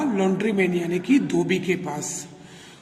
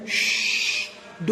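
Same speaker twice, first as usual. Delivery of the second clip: long hushing "shh"; sustained voiceless shush; shushing sound; 3 semitones lower than usual. Acted. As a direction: shush someone with a drawn-out hiss. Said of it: "The needle woman said".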